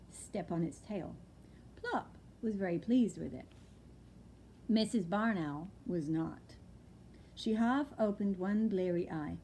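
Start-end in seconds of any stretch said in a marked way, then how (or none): none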